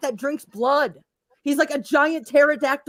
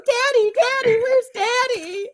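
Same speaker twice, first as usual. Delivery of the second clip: put-on high, slow childlike whine; whiny child voice; 13 semitones higher, slower than usual. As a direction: call for Daddy like a lost kid. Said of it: "I'm going".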